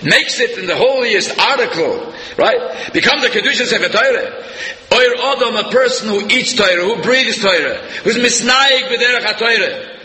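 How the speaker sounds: rising to full preaching fervour; fervent preaching. Acted. Rabbi preaching to his congregation, high and impassioned.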